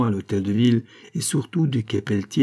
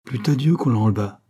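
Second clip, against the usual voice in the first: ordinary delivery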